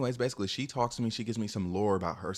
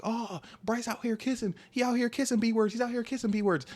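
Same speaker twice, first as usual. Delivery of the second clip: crowd hyping him up, much higher; 13 semitones higher than usual. Retold or imitated. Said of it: "And they were like".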